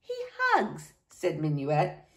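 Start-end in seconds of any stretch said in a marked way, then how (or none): 0.0-0.9 put-on soft, very high child's voice; little niece, wistful. A narrator's reading. Niece telling uncle what daddy does, small voice.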